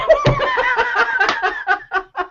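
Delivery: a high laugh; high giggle. Real, not acted. Laughing at his story.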